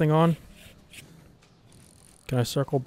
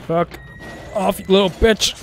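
angry shout; 5 semitones higher, 5 dB louder, slower than usual. Real, not acted. Gamer yelling at enemy.

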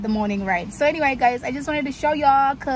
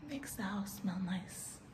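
whispering; 3 semitones lower, 18 dB quieter, slower than usual. Real, not acted.

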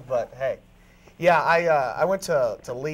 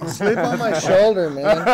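mock tender; 3 semitones higher, 7 dB louder, slower than usual. Mock comforting sleepy bandmate.

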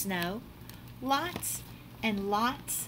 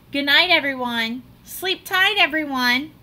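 calling out; 4 semitones higher, 7 dB louder than usual. Acted. Calling good night to family.

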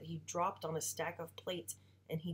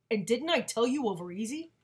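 higher, louder annoyed father; irritated complaint; 5 semitones higher, 8 dB louder than usual. Acted. Father complaining to mom about his eggs, irritated.

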